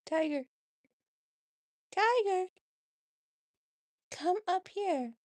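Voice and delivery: high, loud pet call; calling the cat. Real, not acted. Owner calls her cat onto the bed, high and loud. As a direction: call him up, bright and loud.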